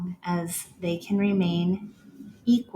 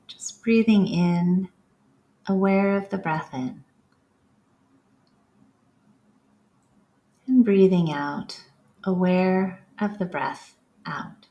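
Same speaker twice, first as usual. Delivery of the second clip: calm, unhurried meditation guide voice; guided breathing cues. Acted. Yoga teacher guiding the class through breaths, calm meditation voice. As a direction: lead them through each breath with long pauses.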